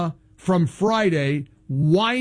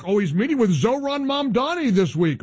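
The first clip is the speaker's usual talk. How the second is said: normal talking voice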